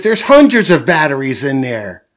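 put-on astonished kid, belting it out; Peppa's amazement. A narrator's reading. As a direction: marvel out loud like a little girl. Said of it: "Peppa says".

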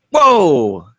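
whoa, fake surprise at the gong; mock startled. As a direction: jump at the sudden gong.

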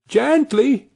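high soothing call repeated. A narrator's reading. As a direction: keep calming the horse, a tiny boy's voice. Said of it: "Tom was calling out".

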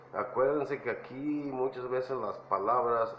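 ordinary delivery

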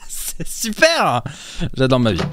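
sarcastic. Real, not acted. Sarcasm after no explanation.

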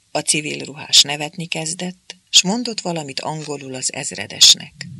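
plain reading voice

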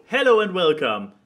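loud, high podcast host "Hello and welcome."; show opening greeting. Retold or imitated. Quoting the podcast intro to co-hosts, lightly announcer-like. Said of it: "actually the opening of this show".

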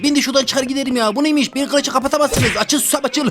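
put-on high, pleading little-kid voice; whiny kid voice. Trapped in kindergarten, begging the caretaker lady: high whiny kid voice. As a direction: play a grown man stuck in kindergarten, whining. Kid character complaining, escaping.

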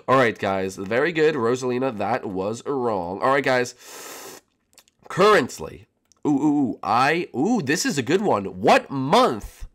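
normal talking voice

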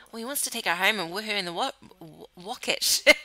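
"wocket ship" said with a W instead of the R of rocket; slip wocket ship. Real, not acted. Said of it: "I was gonna say wocket ship".

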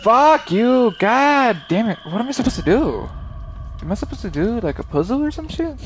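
high, loud frustrated yelling; gamer rage outburst. Real, not acted. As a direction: blow up at the game. Stuck in the game, raging.

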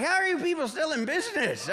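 high incredulous mock rant; a hint of fed-up shopper; mock outrage. Shopper at Whole Foods, high mock outrage. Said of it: "I was mad".

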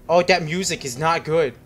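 ordinary talk, nothing marked

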